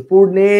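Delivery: পূর্ণে with the final e held long and loud; stress on final vowel. Shown as a demonstration. Teacher pointing out the e-kar, পূর্ণে with its last vowel drawn out. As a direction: lean hard on the e ending of পূর্ণে. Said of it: "এই যে আমাদের এখানে একার আছে".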